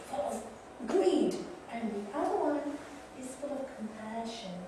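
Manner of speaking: usual speaking voice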